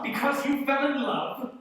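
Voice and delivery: high, scornful disbelief; incredulous scorn. Acted. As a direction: throw his words back at him. Scrooge mocks nephew's reason.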